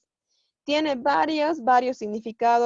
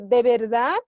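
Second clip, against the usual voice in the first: "De verdad" as a question, louder with a rise on "verdad"; questioning intonation; 6 dB louder than usual. Shown as a demonstration. Teacher giving another example question, questioning intonation. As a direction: ask "De verdad" firmly, voice lifting at the end. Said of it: "Es una pregunta".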